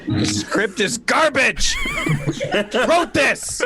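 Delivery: high, laughing mock complaint; laughing aside. Real, not acted. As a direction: crack up mocking the script. Cast member mocking the script.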